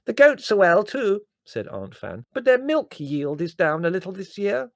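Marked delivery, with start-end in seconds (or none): 0.0-1.3 hard-of-hearing Aunt Fan. A narrator's reading. 2.3-4.7 hard-of-hearing Aunt Fan. A narrator's reading.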